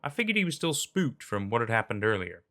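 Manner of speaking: plain reading voice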